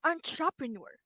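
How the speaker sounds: careful demo of entrepreneur, stress on the last syllable; model pronunciation. Shown as a demonstration. Teacher modelling entrepreneur for Jenny. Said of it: "is pronounced as".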